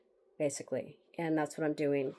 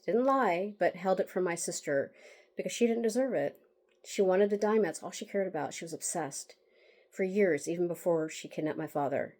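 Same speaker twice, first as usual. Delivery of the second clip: ordinary talk, nothing marked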